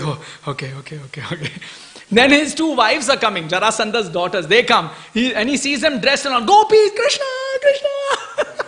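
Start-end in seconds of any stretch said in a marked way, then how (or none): 0.0-1.5 low, soft mock relief; calmed down. Kamsa told it is only a column, calming down. 6.5-8.6 very high, hammed-up shriek ending in laughter; mock scream. Kamsa seeing his dressed-up wives, mock screaming. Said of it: "He's screaming".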